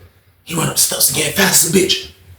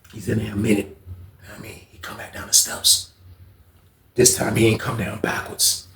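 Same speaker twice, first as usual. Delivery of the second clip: ordinary talk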